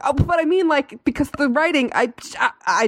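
flustered backpedalling. Retold or imitated. Retells embarrassed backpedalling.

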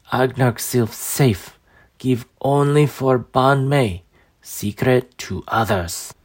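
slow, pleading frail crone; dying old woman. Acted. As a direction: plead slowly with fading strength.

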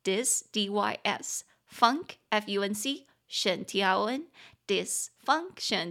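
ordinary talk, nothing marked

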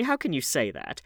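loud, high protesting question; crying out. A narrator's reading. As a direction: exclaim it in objection. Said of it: "cried Lord Henry".

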